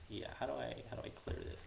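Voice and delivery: very soft, absent-minded question; muttering to self. Real, not acted. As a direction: think aloud under your breath. Thinking aloud at the screen.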